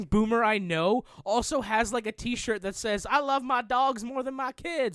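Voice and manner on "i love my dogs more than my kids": put-on Southern accent. Quoting a boomer's t-shirt, mock Southern accent. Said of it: "I gave them that offensive Southern accent".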